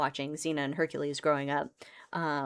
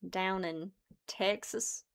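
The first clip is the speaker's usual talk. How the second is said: slow, softer Texan drawl; Southern drawl; 6 dB quieter, much slower than usual. Shown as a demonstration. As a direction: stretch it out like a Texan, in a Southern accent. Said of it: "that Southern drawl".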